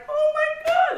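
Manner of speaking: high, shocked "oh my God"; high-pitched dismay; shocked gasp. Retold or imitated. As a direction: disbelief hitting all at once. Learning she missed the flight.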